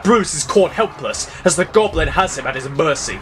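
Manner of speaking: ordinary delivery, nothing marked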